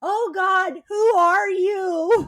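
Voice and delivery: high, drawn-out alarmed "who are you"; horrified recognition. Retold or imitated. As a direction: act shocked by a stranger. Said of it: "And you're like".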